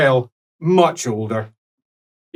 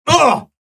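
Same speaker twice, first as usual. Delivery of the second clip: effortful grunt; 13 semitones higher, 4 dB louder than usual. Acted. Old man stands up grunting.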